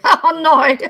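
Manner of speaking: high, loud laughing "oh no"; amused dismay breaking into laughter. Real, not acted. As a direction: burst out laughing through the words. Laughing at own slip.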